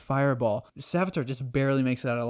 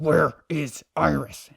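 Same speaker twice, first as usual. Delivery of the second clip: higher, louder, slow demand; demanding villain; 4 semitones higher, 6 dB louder, slower than usual. Retold or imitated. Savitar demanding Iris from Barry, slow angry. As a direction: bite out each word. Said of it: "And Savitar's like".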